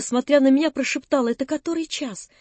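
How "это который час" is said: softer and higher, in aching dread; a hushed, anxious question; anguished whisper. A narrator's reading. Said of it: "с невыразимой болезненной тоской". (тихо, с болезненной тоской)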